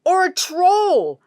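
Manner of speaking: shouted. Acted. Hack warning her brother while running, loud shout. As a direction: call it out as a warning. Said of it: "shouted Hack".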